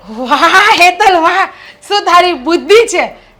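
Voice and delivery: loud, thrilled praise of a clever plan; delighted praise. Acted. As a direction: cheer her cunning idea out loud. Sister-in-law praises scheming idea.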